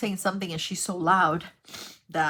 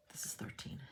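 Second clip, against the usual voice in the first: whispering; 16 dB quieter than usual. Real, not acted. Aside while doing puzzle count.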